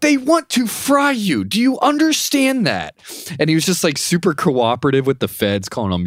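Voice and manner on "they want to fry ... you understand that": squeaky incredulous lawyer impression; exasperated lawyer. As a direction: do the fed-up lawyer way up high. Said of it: "He's like".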